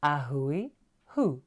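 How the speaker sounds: slow A hui hu, each syllable drawn out; slow teaching repeat. Shown as a demonstration. Teacher repeats A hui hu slowly for viewers to copy. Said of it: "Come on".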